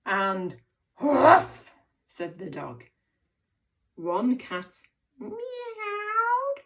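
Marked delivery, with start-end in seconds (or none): 1.0-1.7 loud, higher, rough bark; expressive dog woof. Shown as a demonstration. Teacher leading children in animal sounds, expressive dog woof. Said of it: "say this with loads of expression". 5.2-6.6 high, stretched-out meow within the word; meowing like a cat. Shown as a demonstration. Teacher reading with children, meowing like a cat. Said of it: "just like animals".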